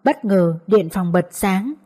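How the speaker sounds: ordinary delivery, nothing marked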